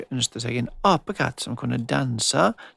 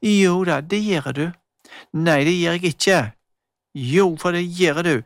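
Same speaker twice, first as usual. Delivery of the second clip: put-on insistent child, a touch louder; mild quarrel; 5 semitones higher, 5 dB louder than usual. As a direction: argue back, not giving in. Girls quarrel back and forth.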